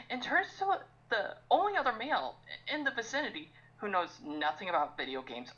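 usual speaking voice

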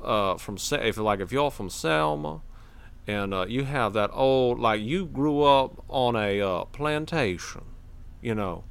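put-on old Southern accent. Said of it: "the old antebellum South".

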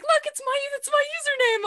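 very high, squealing delight; giddy excitement. Retold or imitated. Fan squealing at her own username on air. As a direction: squeal like you just saw yourself on TV.

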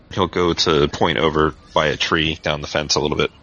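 ordinary talk

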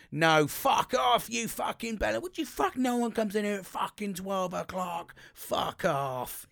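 high-pitched furious swearing outburst; swearing tirade. Retold or imitated. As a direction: snap and rant, flying off the handle. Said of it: "And I'd be like".

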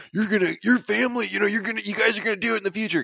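high, quick imitation of relatives foretelling his future; voiced family elder. Retelling what his family told him, in a high quick voice.

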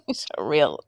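amused jab squeezed out through a laugh. Real, not acted. Co-host joins in trashing a disliked character, laughing. Laughing at co-host's grudge list.

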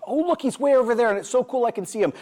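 high, mock-giddy sightseer; excited onlooker. Voicing distant admirers of Spirit.

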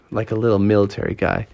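usual speaking voice

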